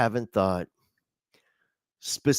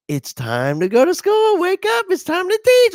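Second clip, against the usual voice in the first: high, loud holler climbing upward; mock-motherly high yell; mom's wake-up call; 6 dB louder than usual. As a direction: impersonate mom rousing a sleeper. Mom waking new teacher for school.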